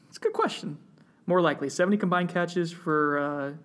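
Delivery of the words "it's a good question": very high-pitched, playful replay; voice going up. Shown as a demonstration. Podcast host replaying the voice jump for co-host, voice going up. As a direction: send the voice right up high. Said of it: "you like how my voice went up".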